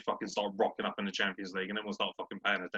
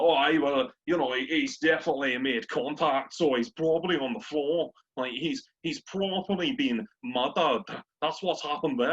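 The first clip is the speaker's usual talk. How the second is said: louder, half-decent Scottish pundit impression; Scottish accent; 2 semitones higher, 3 dB louder than usual. Football fan mocking a pundit's excuses, Scottish accent. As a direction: put on a Scot excusing a dive. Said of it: "I can do a half-decent Scottish accent".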